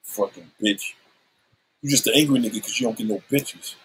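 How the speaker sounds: ordinary talk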